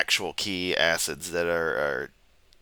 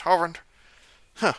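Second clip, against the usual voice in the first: Hovind shout; 9 semitones higher than usual. Shown as a demonstration. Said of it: "go back into the Hovind voice".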